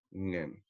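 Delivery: soft "Ngen" opening on a nasal ng. Shown as a demonstration. Teacher isolating the nasal, soft "Ngen". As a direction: start "Ngen" quietly in the nose. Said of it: "ahí está el nasal".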